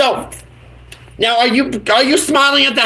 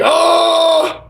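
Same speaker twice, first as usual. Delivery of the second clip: surprised; 7 semitones higher than usual. Real, not acted. Reacting to goofy filter.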